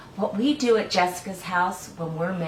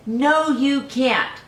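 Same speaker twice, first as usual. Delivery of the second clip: higher, slow, drawn-out refusal; slow firm mom no; 7 semitones higher, 5 dB louder, much slower than usual. Acted. Mom stopping the egg juggling, slow and firm. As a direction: lay down the law as mom.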